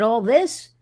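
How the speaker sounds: astonished echo, pitch climbing at the end; surprised question. Retold or imitated. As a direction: ask all this in disbelief. Surprised question at security check.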